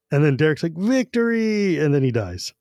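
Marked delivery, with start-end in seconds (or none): 0.8-1.8 last cry. A narrator's reading. Dying knight's last cry.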